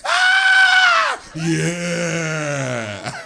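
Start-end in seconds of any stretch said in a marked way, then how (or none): 0.1-1.2 sharp loud high yelp, much higher; shrill mock scream. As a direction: scream like you got burned. Woman yelps, hot bulb.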